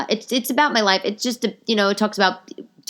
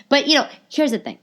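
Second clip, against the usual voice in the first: emphatic announcement; 8 semitones higher than usual. Real, not acted. Podcaster setting up her point, high and emphatic. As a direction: pitch it up to grab attention. Emphatic take before hushed repeat.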